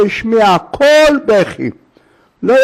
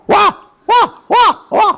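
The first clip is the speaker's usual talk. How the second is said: mock shout; 13 semitones higher, much faster than usual. Rabbi alone in the synagogue yells to scare off thieves, high shouting. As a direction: yell out high like an alarm. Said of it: "התחיל לצעוק".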